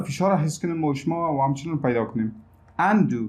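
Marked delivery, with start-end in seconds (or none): 2.8-3.2 UN in Undo stressed over do; primary stress on UN, weaker on do. Shown as a demonstration. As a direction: lean on the first syllable, UN-do.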